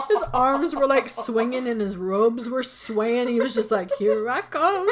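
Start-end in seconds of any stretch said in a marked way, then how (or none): 4.0-4.9 higher, louder mock monster with a laugh; weird Krampus voice. Friend mimics the movie monster approaching, laughing mock menace. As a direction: ham up the creepy monster, giggling. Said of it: "It was really fucking weird".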